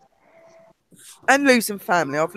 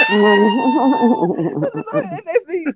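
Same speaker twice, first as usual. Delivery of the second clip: laughing squeal; much slower than usual. Real, not acted. Laughing while telling the fall.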